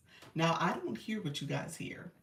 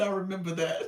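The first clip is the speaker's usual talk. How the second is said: laughing; 3 dB louder, faster than usual. Real, not acted. Laughing as she reads comment.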